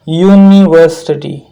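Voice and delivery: यूनिवर्सिटी drawn out slowly with a clear yoo sound at the start; slow teacher demo. Shown as a demonstration. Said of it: "यूनिवर्सिटी अगर आप अदा करते हैं".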